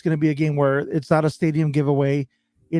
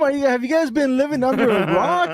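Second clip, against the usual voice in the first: being a dick; 9 semitones higher than usual. Retold or imitated. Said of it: "I was just being a dick".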